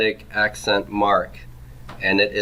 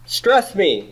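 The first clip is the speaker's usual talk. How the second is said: higher, louder "yo" to grab attention; metheg speaking up; 7 semitones higher, 5 dB louder, much slower than usual. Acted. Teacher voicing the metheg to the class, calling out for attention. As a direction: call out as a pushy accent mark. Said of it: "the metheg is saying".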